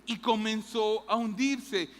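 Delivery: ordinary talk, nothing marked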